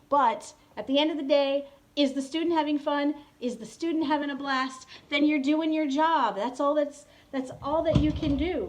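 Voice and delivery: rally fellow teachers with conviction; loud motivational wrap-up to teachers; emphatic pep talk. Real, not acted.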